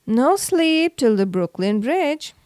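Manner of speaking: ordinary delivery, nothing marked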